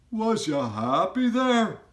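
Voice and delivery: high, loud, odd giant voice with a rough edge of kindness; BFG giant voice. A narrator's reading. Giant asks Sophie if she is snug, high and loud. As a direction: voice the friendly giant fussing over the girl.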